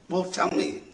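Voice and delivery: high, soft voice of a son; eager son. Acted. Son urges his father to explain, imitated eager son. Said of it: "this young man says to his dad".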